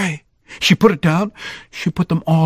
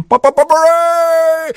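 high, loud stadium cheer; be the whole crowd roaring; 12 semitones higher, 3 dB louder than usual. Acted. Child voicing the cheering stadium crowd, high and excited.

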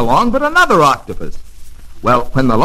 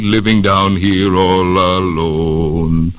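slow, drawn-out lonesome octopus song-voice; a sad octopus moaning slowly to himself; lonely octopus; 2 semitones lower, slower than usual. Acted. Octopus sings his lonely song.

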